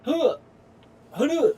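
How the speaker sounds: high, loud effortful grunts; strained grunt. Real, not acted. Gamer fighting the crane controls, sharp straining grunts. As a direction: grunt hard like lifting something heavy.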